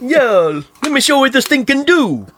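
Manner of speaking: put-on, high-pitched show-off biker; Andrew Dice Clay. Biker showing off his ride, hammed-up tough-guy voice. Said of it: "almost a doppelganger of Andrew Dice Clay".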